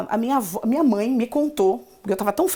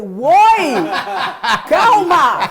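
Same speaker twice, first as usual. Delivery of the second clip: startled exclamation; 2 semitones higher, 7 dB louder, much slower than usual. Retold or imitated. Teacher reacting to her student's accidental swear, loud startled halt. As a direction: cry out in alarm, half laughing. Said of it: "Aí eu falei".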